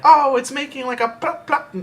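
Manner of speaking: high, light, delighted plop plop; a bright voice full of wonder for the plop sound; high wife voice. Retold or imitated. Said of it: "Kelsey was like".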